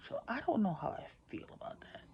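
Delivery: hushed, unsure whisper. Real, not acted. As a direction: whisper it as an uneasy aside. Uneasy aside to viewers.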